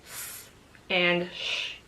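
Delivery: s then sh, slow and careful; s and sh sounds. Shown as a demonstration. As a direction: articulate s and sh slowly. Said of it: "properly articulate the sound".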